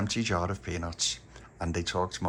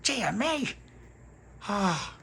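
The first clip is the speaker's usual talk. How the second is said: very high dismayed tut; tutting shopkeeper; 13 semitones higher, much slower than usual. A narrator's reading. Joe tutting at the story, high. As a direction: tut it, scandalised.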